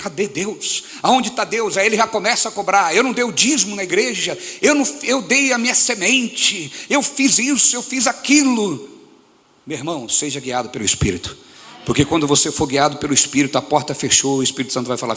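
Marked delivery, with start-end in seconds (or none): 0.0-8.9 complaining believer. Retold or imitated. Pastor mocks a complaining believer, high and whiny. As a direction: mimic the believer demanding payback. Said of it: "Ele fica".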